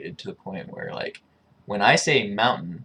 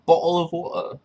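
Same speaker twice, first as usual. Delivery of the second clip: higher, louder mock British "Bottle of water"; hammed-up British accent, T's dropped from "Bottle of water"; British meme voice; exaggerated British accent; 8 semitones higher, 6 dB louder than usual. Shown as a demonstration. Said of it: "The British meme is more exaggerated".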